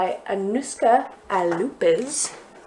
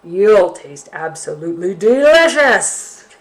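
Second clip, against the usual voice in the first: gloating bear; 9 dB louder, slower than usual. Acted. Bear gloating about eating.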